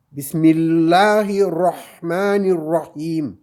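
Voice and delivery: Bismillahirrahmanirrahim slowly, the ra said almost like ro; ra toward O. Shown as a demonstration. Teacher showing the Quranic ra, slow recitation. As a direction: round the ra of rahman toward O. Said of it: "c'est presque un O".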